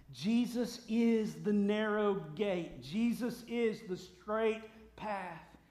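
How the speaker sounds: loud, high, emphatic declaration; impassioned preaching. Real, not acted. Preacher defending the straight way of the gospel, impassioned. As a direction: drive each phrase home from the pulpit.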